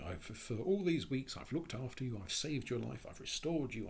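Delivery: lightly acted dying woman's bitter plea; pleading reproach.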